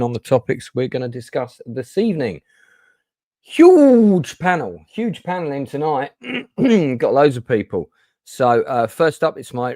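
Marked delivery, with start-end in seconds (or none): 3.4-6.1 loud, high, long-drawn opening word; hyped announcement. Real, not acted. Host hyping the line-up to viewers, loud hyped announcement. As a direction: stretch it out big like a show announcer.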